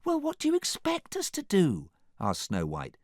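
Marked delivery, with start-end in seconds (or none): 0.1-1.9 very high, protesting princess voice. A narrator's reading.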